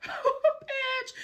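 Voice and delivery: high laughing exclamation; real laugh bursting through the word. Real, not acted. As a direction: laugh it out in disbelief.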